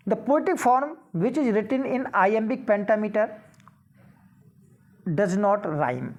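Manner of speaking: reads the exam question aloud, very slow and deliberate; slow question reading. Acted. Teacher reading a quiz question to students, slow and measured. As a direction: spell out each word of the question for the class.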